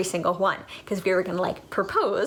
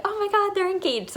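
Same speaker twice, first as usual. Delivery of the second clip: mock shocked cry; 11 semitones higher than usual. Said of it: "everyone was like".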